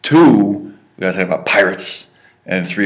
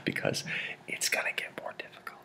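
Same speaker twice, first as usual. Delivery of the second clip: whispered teasing warning; playful whisper; 13 semitones lower, 18 dB quieter than usual. Acted. Teacher whispering to students that it gets harder. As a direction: hush it like a spooky secret.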